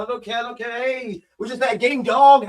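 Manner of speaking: ordinary delivery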